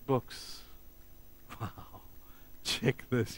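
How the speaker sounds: hushed, breath-only amazement; whispered marvelling, as the stunned visitor; imitating awestruck wonder. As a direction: act out jaw-dropped awe, barely voiced. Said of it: "his jaw just fell open as he looked around".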